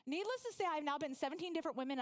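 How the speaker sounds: ordinary delivery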